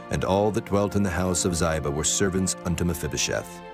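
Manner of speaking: ordinary delivery, nothing marked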